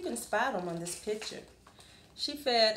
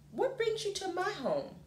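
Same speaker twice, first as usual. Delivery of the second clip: a lightly raised, high voice for Amu; Amu's voice; 9 semitones higher than usual. Acted. Amu asking the hot dog vendor at her door, light high voice. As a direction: ask the visitor kindly in a lightly voiced girl's tone. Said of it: "Amu explained".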